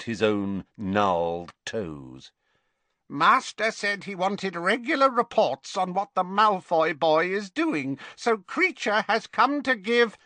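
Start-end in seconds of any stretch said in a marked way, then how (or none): 3.1-10.2 put-on a resentful old elf, muttering reports; grumbling old house elf. House elf Creature speaks.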